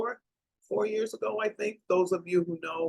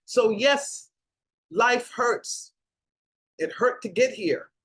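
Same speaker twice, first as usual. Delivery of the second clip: usual speaking voice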